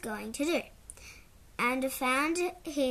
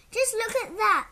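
exclaiming; 9 semitones higher, 8 dB louder than usual. Acted. Dad exclaims at children.

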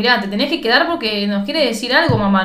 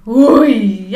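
usual speaking voice

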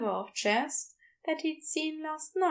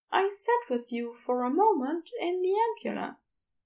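higher, gentle Aziraphale explaining; higher Aziraphale telling Crowley what happened; 6 semitones higher than usual. A narrator's reading. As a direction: raise the pitch and soften the bad news. Aziraphale recounts.

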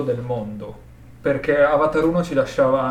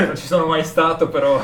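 ridendo; 4 semitones higher, 7 dB louder than usual. Real, not acted. Joke about 80s dance halls.

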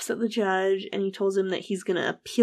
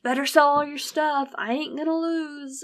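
retold yell; 7 semitones higher than usual. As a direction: threaten the judge on the way out. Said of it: "he's yelling at the judge".

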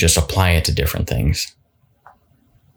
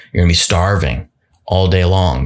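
usual speaking voice

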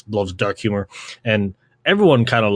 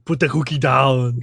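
Arnold impression. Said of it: "the Arnold thing".

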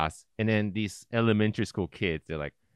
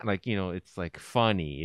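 ordinary talk

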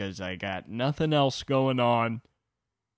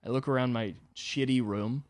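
ordinary talk, nothing marked

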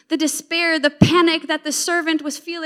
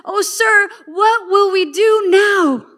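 higher, drawn-out cry of alarm; panicked cry; 4 semitones higher, 5 dB louder, slower than usual. Acted. Servant to Elisha on seeing enemy troops, panicked cry. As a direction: plead loudly, stretching out the words. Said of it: "this guy's panicking".

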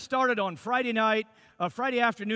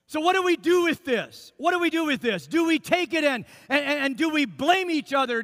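higher and louder, rousing the congregation; preaching; 4 semitones higher, 4 dB louder than usual. Acted. Pastor urging husbands to bless their wives, rising preaching. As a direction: lift it into an earnest sermon appeal.

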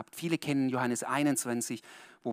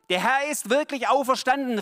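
louder, higher and fast; excited proclamation; 9 semitones higher, 8 dB louder than usual. Acted. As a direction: cry it out in joyful news. Disciples exclaiming.

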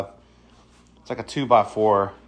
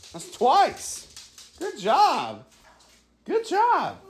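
potty training praise; slower than usual. Real, not acted. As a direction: cheer him on, way up high. Child just used the potty.

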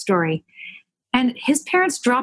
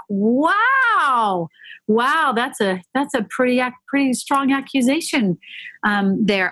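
shocked reaction; 3 semitones higher, slower than usual. Retold or imitated. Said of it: "I didn't say those words".

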